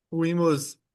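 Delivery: normal talking voice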